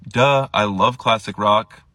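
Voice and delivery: slow, loud know-it-all duh; smug kid. Retold or imitated. Middle schooler calling Blink classic rock, smug and slow. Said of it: "He goes".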